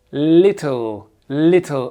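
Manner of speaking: "Little" ending in a hollow L, almost "litto"; dark L. Shown as a demonstration. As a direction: darken the final L of "Little". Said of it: "On dit pas little".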